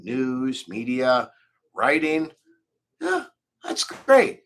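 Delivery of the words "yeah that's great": mock enthusiasm. Mock reaction to own success.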